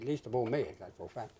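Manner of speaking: normal talking voice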